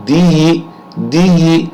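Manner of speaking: دی with a long stretched ی; teacher stretches the vowel in دی; lengthened dee. Shown as a demonstration. Teacher showing students the long ی after kasra, drawn out. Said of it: "وہاں آواز بڑھے گی".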